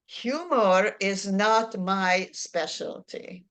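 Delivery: ordinary talk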